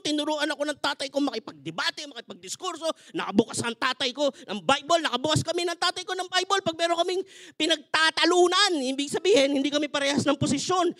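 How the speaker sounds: ordinary talk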